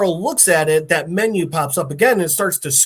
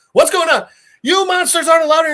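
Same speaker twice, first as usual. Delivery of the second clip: high-pitched squawk from inside; startled doorkeeper; 11 semitones higher, 4 dB louder, faster than usual. Retold or imitated. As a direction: act out a flustered guard calling through a door. Creature answers behind door.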